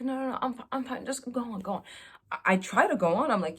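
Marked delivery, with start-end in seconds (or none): 0.0-1.8 softly voiced flustered therapist.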